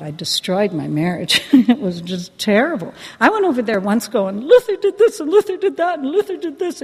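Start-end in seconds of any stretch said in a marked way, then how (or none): 4.4-6.8 high-pitched, singsong complaint list; hammed-up shrill griping; whiny tattling. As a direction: mimic yourself whining like a tattletale. Imitating own whining about husband.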